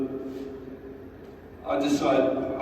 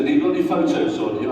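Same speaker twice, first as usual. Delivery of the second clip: suspicious guard; 6 semitones higher, 4 dB louder, faster than usual. Retold or imitated. Guard interrogates about photos.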